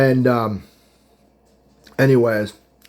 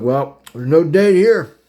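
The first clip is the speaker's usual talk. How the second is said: slow dry remark; 5 semitones higher, 5 dB louder, slower than usual. Retold or imitated. Realizing date is off.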